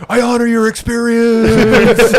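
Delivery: high, slow, mock-earnest voice; mock solemn. Mocking a friend who says he wasn't laughing, high and solemn. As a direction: intone it like a fake sincere counselor.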